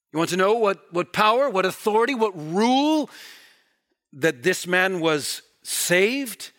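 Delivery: loud, high, impassioned preaching; full-force preaching. Acted. Preacher thundering at the congregation about the court, loud and high. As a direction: drive it home to the congregation, full voice.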